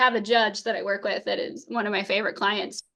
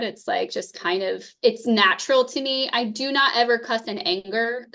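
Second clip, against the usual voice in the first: usual speaking voice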